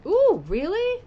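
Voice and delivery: high, loud, teasing "ooh, really"; mock surprise. Real, not acted. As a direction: pretend to be amazed to tease him. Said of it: "My voice keeps cracking".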